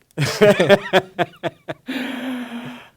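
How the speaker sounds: a long, loud, high burst of laughter; hearty laugh. Real, not acted. Asked about his proudest mistake, bursting out laughing. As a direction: crack up at the question.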